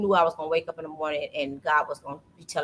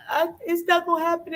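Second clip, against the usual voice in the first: high-pitched mock crying; imitated tearful wailing; 11 semitones higher than usual. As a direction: whimper it high, as if in tears. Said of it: "I bust out in tears".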